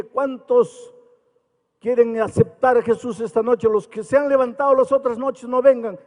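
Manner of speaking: a touch of pulpit force; retold preaching call. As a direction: lift the voice as if calling the crowd again. Retold altar call, raised voice.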